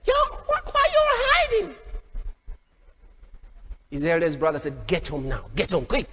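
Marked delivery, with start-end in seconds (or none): 0.0-1.8 very high-pitched incredulous call; incredulous challenge. Acted. Young David to hiding soldiers, incredulous high-pitched challenge. 4.9-6.1 very low, stern command; stern order. Acted. Eldest brother sending David home, low stern order.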